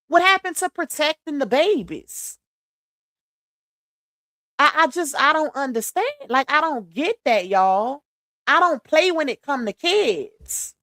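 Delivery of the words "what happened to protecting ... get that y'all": ordinary delivery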